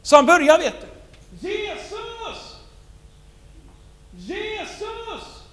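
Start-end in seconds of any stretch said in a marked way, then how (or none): 1.5-5.4 ropar högt efter Jesus; calling out. Acted. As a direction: call out desperately, high. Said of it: "Jag kan ropa". (ropar förtvivlat)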